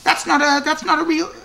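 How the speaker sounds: excited refusal; loud objection. Retold or imitated. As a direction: shut the word down with repeated no. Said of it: "I'm saying, like".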